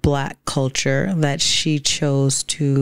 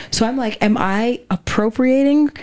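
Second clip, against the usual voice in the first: normal talking voice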